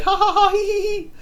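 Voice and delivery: high giggly voice, laughing through the words; imitating a giggly girl; mock giggling. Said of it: "And she's like".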